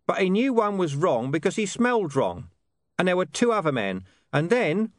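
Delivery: high-pitched character voice. A narrator's reading. Hidden speaker makes excuses for an old dog, explanatory. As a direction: explain it from hiding, pitched well up.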